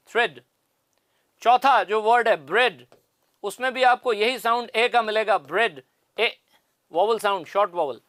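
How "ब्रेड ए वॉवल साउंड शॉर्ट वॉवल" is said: usual speaking voice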